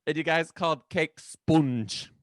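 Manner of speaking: loud, slow northern British accent; northern English accent, hammed up. Shown as a demonstration. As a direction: stretch sponge like a northern Brit. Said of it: "You went northern there with sponge".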